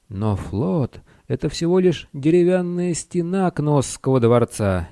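lightly voiced little wizard, rising high; a hint of an anxious adviser; protesting little wizard. A narrator's reading.

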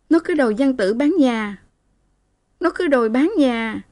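lightly acted distress; plaintive explanation. Daughter plaintively explains the beating.

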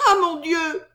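cri aigu d'effroi; s'exclamer, voix haute et forte; s'écrier. A narrator's reading. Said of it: "s'écria Susan". (Susan effrayée par le duel, cri aigu)

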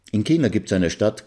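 ordinary delivery

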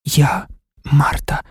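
slow, breath-only whisper; whispered reply. A narrator's reading. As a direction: whisper it back slowly. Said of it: "шепотів він".

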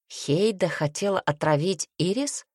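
usual speaking voice

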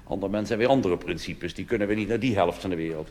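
ordinary talk, nothing marked

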